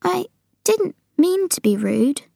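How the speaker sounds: high, contrite voice of Sophie; Sophie apologising. Acted. Sophie sorry for being rude to the giant, contrite.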